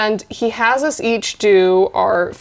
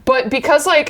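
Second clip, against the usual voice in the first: ordinary delivery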